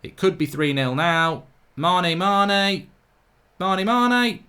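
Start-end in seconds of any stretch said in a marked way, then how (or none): 1.8-4.4 rising excited shout of the name; excited cheer. Real, not acted. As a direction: call the player's name as he goes through. Cheering a chance live.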